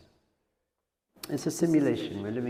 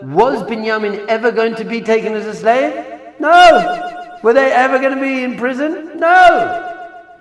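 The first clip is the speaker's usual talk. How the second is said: high, very loud rhetorical challenge; emphatic shouting; 9 semitones higher, 11 dB louder than usual. Real, not acted. Preacher dismissing the brothers' fears, emphatic shouting. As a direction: shout each question and blast out the answer.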